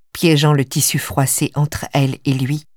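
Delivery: ordinary delivery, nothing marked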